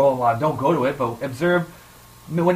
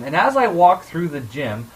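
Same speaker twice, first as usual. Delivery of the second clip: ordinary delivery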